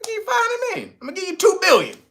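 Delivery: high, wheedling pushy offer; mock America bribing. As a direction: coax them to take the money. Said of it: "what America knows".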